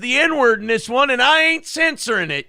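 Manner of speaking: high, loud yokel hollering. Acted. Radio bit opener, character shouting.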